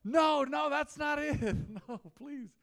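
high, loud pleading voice; playfully pleading no no; mock exasperated pleading. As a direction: act out begging them to stop. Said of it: "I'm like".

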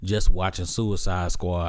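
normal talking voice

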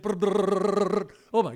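rolled-lip brr of juddering brakes; imitated brake rattle. Pilot imitating his brakes juddering on the snow, rolled brr. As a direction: mimic the anti-lock brakes chattering.

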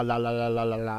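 low monotone run of la-las; babbled la-la-la. Pretend. Podcaster mangling a word mid-intro, babbled low la-la-la. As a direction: drone la la la on one low note.

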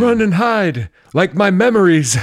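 high, loud cartoonish forgetful star; loud goofy character voice. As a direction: shout it like a confused old star. Joking about a forgetful actor.

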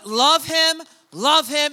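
high, loud, ringing preacher's shout; God's urging. Retold or imitated. Pastor voicing God telling him to love Zach, loud high shout. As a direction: let it ring out like a divine command, building. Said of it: "Felt God kept saying".

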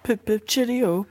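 high, loud, slow mock British; badly faked British, hammed up; bad British accent. Shown as a demonstration. Cohost shows his prepared accent on request, terrible British. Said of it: "what countries did you have?".

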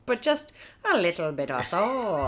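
mock Irish lilt on soul; a hammy put-on Irish accent; bad Irish accent. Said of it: "the worst Irish accent".

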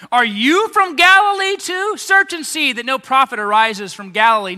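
high, loud scornful jab; loud derisive challenge; mocking retort. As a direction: sneer at him like an offended Pharisee. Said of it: "how do they respond with him".

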